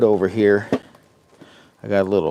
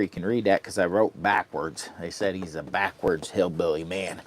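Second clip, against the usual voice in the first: ordinary talk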